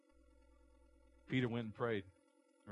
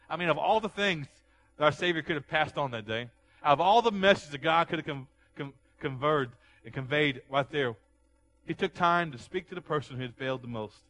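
loud, high, rising preacher's cry; fiery pulpit preaching; preaching at full force; 4 semitones higher, 10 dB louder than usual. Acted. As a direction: build the sermon to a shout.

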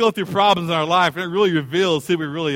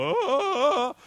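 gorilla hoots; 9 semitones higher than usual. Retold or imitated. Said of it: "making his gorilla sounds and noises".

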